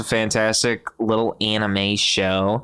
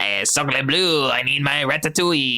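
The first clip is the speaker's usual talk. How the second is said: put-on French accent. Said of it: "do a French accent now".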